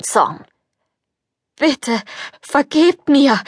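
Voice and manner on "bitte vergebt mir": higher, slow, begging for mercy; frightened plea. Acted. Prince on his knees begs the fairy, frightened. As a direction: beg her forgiveness in fear. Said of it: "rief er und fiel auf die Knie".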